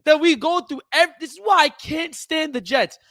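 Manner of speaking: getting heated. Real, not acted. Fed-up fan ranting at the Jets fan about the same thing every year, heated. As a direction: let the irritation boil over at the Jets. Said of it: "I'm about to get tight".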